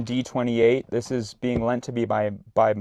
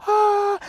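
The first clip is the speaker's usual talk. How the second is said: short high shriek; high scream; 8 dB louder than usual. Retold or imitated. Scared girls in the next tent, short high shriek.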